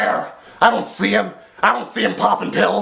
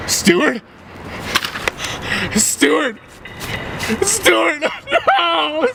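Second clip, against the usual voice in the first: rising higher with each call; panicked calling; 7 semitones higher, much slower than usual. Acted.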